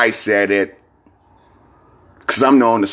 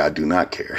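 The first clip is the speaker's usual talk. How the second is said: "I do not care" softer and lower; quiet mock quote; 5 semitones lower, 6 dB quieter than usual. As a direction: mumble "I do not care" like the expected line. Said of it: "She thought I was gonna say".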